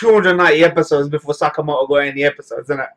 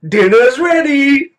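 slow drawn-out call, higher and louder; calling kids to dinner; 9 semitones higher, 5 dB louder, much slower than usual. Pretend. Catchphrase calling out dinner again.

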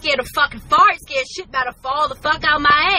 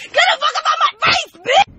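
very high yelled threat; yelling at lurker; 14 semitones higher than usual. Retold or imitated. Screaming at a lurker, very high yell. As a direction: scream them off your face. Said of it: "I don't gotta be like".

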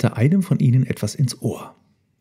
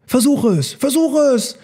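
high, urging elephant calls; calling out; 14 semitones higher, 3 dB louder than usual. A narrator's reading. Herd urges Elmar to try, calling out high. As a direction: urge him on in a high voice. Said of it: "riefen die Elefanten".

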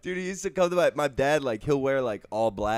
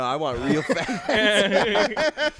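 laughing; 7 semitones higher, 6 dB louder, much slower than usual. Real, not acted. Laughing while pressing the question.

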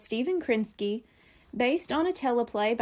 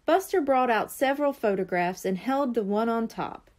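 usual speaking voice

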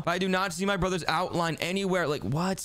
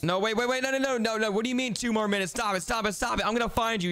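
frantic protest; 6 semitones higher than usual. Real, not acted. Protesting brother's two-minute countdown.

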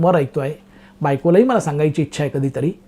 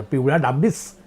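incredulous title repeat; 5 semitones lower, 3 dB quieter than usual. Acted. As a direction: question the absurd title with a rising end.

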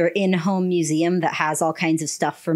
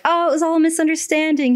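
high, airy mock realization; oh-silly-me tone, pitched up; mock relief; 8 semitones higher than usual. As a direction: play the happy twist in a lifted voice. Voicing mock relief at a twist.

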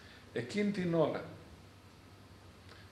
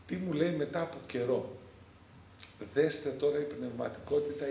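ordinary talk

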